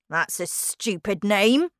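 usual speaking voice

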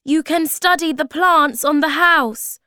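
high, loud suggestion; calling out. A narrator's reading. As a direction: project to someone at a distance.

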